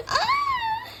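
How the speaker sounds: very high sudden scream; pained shriek. Acted. Owner clawed by the cat, high pained shriek. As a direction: shriek as the claws land.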